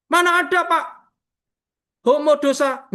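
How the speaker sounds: a hint of a cocky young man protesting; student's reply. Student answering pastor's question.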